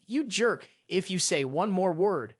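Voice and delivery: usual speaking voice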